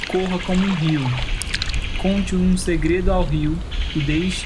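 slow, gentle guiding voice; guided meditation. Acted. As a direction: lead them slowly into the river. Leads a guided river meditation.

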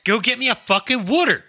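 high, loud Jersey demand; hammed-up Jersey accent. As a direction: bark it in a thick Jersey accent. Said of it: "we're from Jersey".

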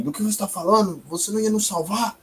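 high, fast, outraged demand; angry. Acted. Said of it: "fica um pouco irado".

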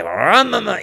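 mock kart engine revving, high and loud; playful imitated motor roar; engine noise. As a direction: vroom like a go-kart taking off.

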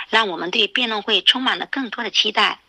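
ordinary delivery

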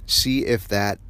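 ordinary talk, nothing marked

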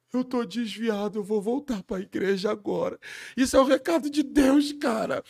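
loud, high emotional outcry; moved shout. Retold or imitated. A fan shouting to the stage, shaken by the confession, loud. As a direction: cry it out to the whole room.

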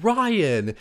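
high, drawn-out mock scold; scolding Sandra. Sandra reproaching Ryan in a high mock scold. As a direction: call his name like a scolding friend. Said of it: "here comes Sandra going like".

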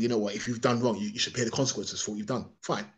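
ordinary talk, nothing marked

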